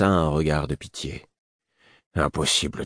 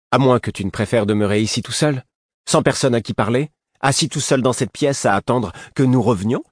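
higher-pitched taunting question; pointed, raised-voice goading; 6 semitones higher, 4 dB louder than usual. A narrator's reading. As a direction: needle someone with a mounting list. Prince goads Lourd about staying alone.

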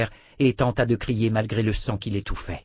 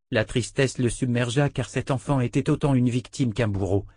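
usual speaking voice

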